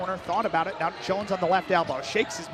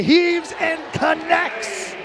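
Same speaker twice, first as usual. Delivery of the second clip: excited call; 7 semitones higher, slower than usual. Real, not acted. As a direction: sell the big three to the crowd. Call of a long made shot.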